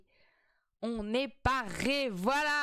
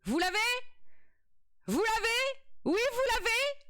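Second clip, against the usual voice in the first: much higher, eager questions; excited; 11 semitones higher than usual. Real, not acted. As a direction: pester the chat with glee. Excited asking the chat. (questions surexcitées, très aiguës)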